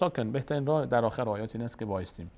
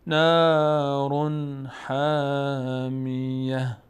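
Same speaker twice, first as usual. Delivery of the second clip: slow, louder, melodic tajwid recitation; Quran recitation; 3 dB louder, much slower than usual. Shown as a demonstration. Teacher reciting the surah for students, slow chant. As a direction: chant each verse slowly with long held vowels.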